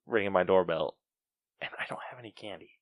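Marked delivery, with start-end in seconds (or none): 1.6-2.7 real furtive whisper, quick and soft; hushed whisper. Real, not acted. Host hiding from doorbell ringers without candy, whispering. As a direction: whisper it so nobody outside hears. Hiding from trick-or-treaters at door.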